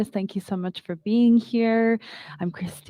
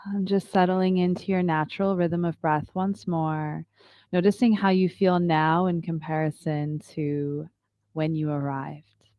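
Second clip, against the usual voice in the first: calm, unhurried yoga teacher voice; guided meditation; 4 semitones lower than usual. Acted. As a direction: guide the class into stillness. Leads a yoga breathing meditation.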